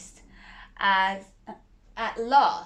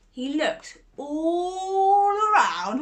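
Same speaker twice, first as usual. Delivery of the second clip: high, slow, drawn-out look around; suspenseful slow; 8 semitones higher, 6 dB louder, slower than usual. A narrator's reading. Narrator building suspense in grandma's cottage, slow and high. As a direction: stretch it out for suspense.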